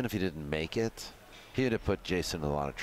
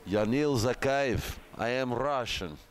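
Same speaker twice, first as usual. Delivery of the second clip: put-on Russian accent; 5 semitones higher than usual. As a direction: announce yourself in a hammy Russian accent. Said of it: "We're done with the Russian accent".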